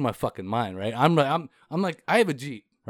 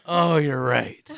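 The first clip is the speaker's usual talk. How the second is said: laughing agreement; 5 dB louder, much slower than usual. Retold or imitated. Retelling his amused agreement.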